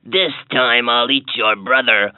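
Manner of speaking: deep troll voice. A narrator's reading. Troll replies.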